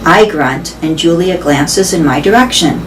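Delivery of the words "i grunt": plain reading voice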